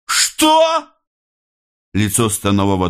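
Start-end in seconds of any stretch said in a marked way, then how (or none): 0.1-0.9 very high, incredulous cry; outraged shriek. A narrator's reading. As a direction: shriek in disbelief at being disobeyed. Chief outraged by the refusal. (возмущённый визгливый вскрик)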